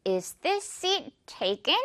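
very slow, "seat" smiled long; slow model question. Shown as a demonstration. Teacher modelling a traveller's polite question. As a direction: ask it slowly with a smiling seat. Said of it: "이렇게 많이 물어요".

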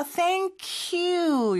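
"thank you" run together as one linked word, drawn out; "thank you" with the k gliding into you; linked pronunciation. Shown as a demonstration. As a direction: slowly link the k of "thank" into "you". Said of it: "이렇게 연음이 되고요".

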